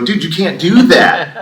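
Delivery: loud, high, drawn-out objection; re-enacted outraged outburst; indignant protest. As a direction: protest loudly, stretching the words in disbelief. Retold argument with state official.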